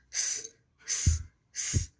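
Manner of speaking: short bursts of hissing; snake hiss. Shown as a demonstration. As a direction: imitate a snake with sharp s sounds. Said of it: "So what snake will do?".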